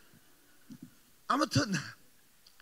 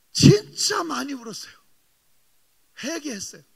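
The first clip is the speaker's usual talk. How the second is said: loud, tearful confession; emotional cry; 3 semitones higher, 10 dB louder than usual. Real, not acted. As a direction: pour out the repentance. (회개를 고백하며 북받쳐 크게)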